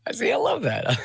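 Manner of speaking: very high laughing reply. Real, not acted.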